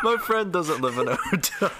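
laughing. Real, not acted.

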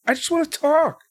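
high, mocking singsong; mock Kendrick voice. Mocking the wrestler's repeated line, high singsong. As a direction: parody his innocent line. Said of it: "'cause he kept saying".

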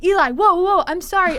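high, loud frightened apology; alarmed shout. Retold or imitated. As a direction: back off in a loud panic. Date apologizing to lunging hobo.

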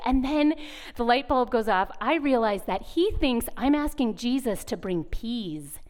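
normal talking voice

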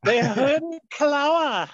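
high, drawn-out mock wail; hammy, stretched-out cartoon shriek; cartoon melodrama cry. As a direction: call it out slowly, high and loud. Imitates cartoon villain's name cry.

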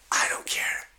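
conspiratorial whisper. Real, not acted. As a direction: confide it behind the wife's back. Aside about wife away.

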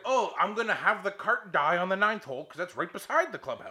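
loud, high mocking rant; heated sarcasm. Golfer mocking the marshal's complaint, sarcastic. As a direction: spell out the absurd logic with exasperation.

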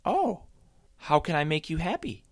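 cartoon bunny eager to cheer her up; Happy Sam. A narrator's reading. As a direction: squeak with eager surprise. Happy Sam voiced in story.

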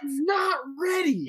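high, mock tearful wail; hammed-up crying voice; sobbing outburst. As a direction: wail it like a heartbroken fan. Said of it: "This dude is sobbing".

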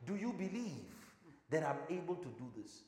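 hesitant soft-spoken question; unsure, quiet question. Shown as a demonstration. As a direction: ask it gently, as if unsure of your power. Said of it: "It doesn't sound like a man who's confident in his power".

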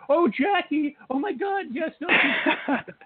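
imitating a delighted shriek of recognition; excited squeal. Acting coach realizes a famous friend called, squealing with excitement.

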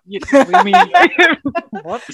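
high, loud laughing disbelief; hammed-up shocked outburst; mock outrage. Mock outraged producers' reaction.

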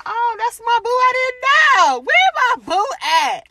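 high, loud gushing squeal; thrilled high-pitched gush; excited squeal. Retold or imitated. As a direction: squeal with delight over your boo. Excited squeal on hearing he's back.

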